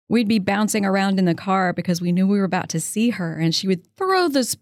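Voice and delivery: normal talking voice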